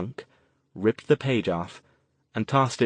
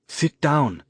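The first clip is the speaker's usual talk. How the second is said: firm, louder order; 5 semitones higher, 4 dB louder than usual. Acted. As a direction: command them to sit. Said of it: "Benny ordered".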